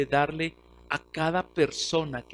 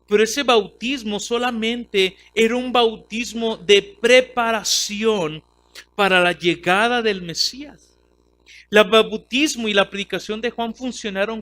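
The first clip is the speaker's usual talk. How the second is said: preaching; 5 semitones higher, 7 dB louder than usual. Acted. Preaching on baptism.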